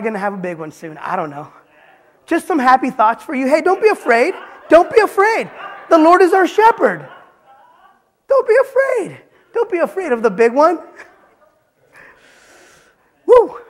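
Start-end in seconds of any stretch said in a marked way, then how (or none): 2.3-10.8 high, loud, ringing cries of comfort; booming reassurance. Real, not acted. Preacher to congregation, shouting not to fear. 13.3-13.6 loud, high joyful whoop. Real, not acted. Preacher whooping to the congregation, loud and high.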